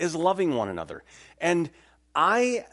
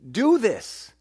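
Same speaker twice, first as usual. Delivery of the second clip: authoritative command; 7 semitones higher, 5 dB louder than usual. Retold or imitated. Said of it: "he's writing with the authority of an apostle".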